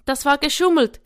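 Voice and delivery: put-on high, loud child's accusation; indignant yell. A narrator's reading. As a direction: cry foul like an outraged boy. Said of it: "schrie Jafet".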